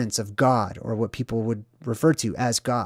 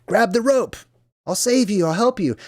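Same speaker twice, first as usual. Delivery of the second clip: helicopter rescuer calling; 8 semitones higher than usual. Retold or imitated. Helicopter rescuer calls to man.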